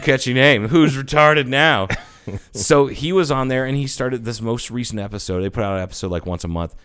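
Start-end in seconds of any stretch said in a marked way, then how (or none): 0.7-1.9 gloating callback. Real, not acted.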